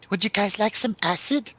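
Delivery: high-pitched imitation of a friend's accent; [name]'s accent. Friend offering the guys acid, in a high imitated accent. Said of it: "I'll do the accent".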